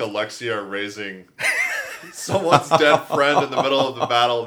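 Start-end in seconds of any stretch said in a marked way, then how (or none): 1.4-4.5 laughing. Real, not acted. Laughing while telling the idea.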